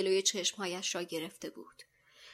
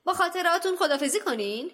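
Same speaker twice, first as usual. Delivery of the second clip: higher, louder gleeful betrayer voice; treacherous teacher gloating at the boys; gloating villain; 5 semitones higher, 7 dB louder than usual. Acted. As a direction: savour the triumph over the children. Lockhart turns villain, gloating.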